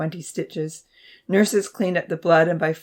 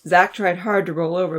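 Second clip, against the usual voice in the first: usual speaking voice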